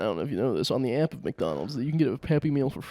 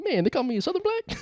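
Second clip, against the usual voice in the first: high-pitched mock indignation; high comic character complaint; indignant Compton guy.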